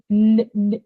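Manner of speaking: short bare "n, n", as in Andy; "n" cut out of Andy, no vowel; isolated N sound. Shown as a demonstration. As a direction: sound out just the "n", twice. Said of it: "it's very different to saying".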